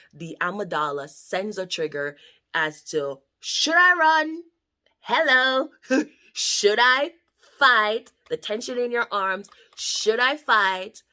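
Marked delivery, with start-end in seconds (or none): 3.4-8.1 high, loud, frantic; the brain screaming should I run; panicked inner voice. Pretend. 9.8-11.0 high and loud, drawn out slowly; the brain's frantic question; panicked inner voice. Pretend.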